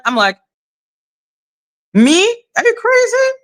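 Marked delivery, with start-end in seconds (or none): 1.9-3.3 high-pitched, disbelieving comeback; playful exaggerated disbelief; mock incredulous. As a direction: act affronted, pitch leaping up into the question. Acted comeback to being blamed.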